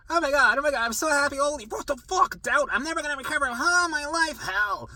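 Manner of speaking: high, loud mock ecstatic gushing; giddy euphoria. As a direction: gush like you just had a breakthrough. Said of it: "So it's like".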